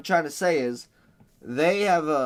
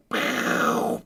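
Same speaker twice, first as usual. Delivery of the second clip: ordinary delivery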